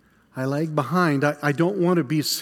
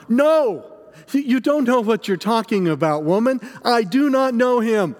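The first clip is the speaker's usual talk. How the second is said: fearful crying out; 3 semitones higher than usual. Acted. Peter denies Jesus to serving woman, fearful outcry. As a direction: cry out a frightened denial. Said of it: "in fear, I, I cry out".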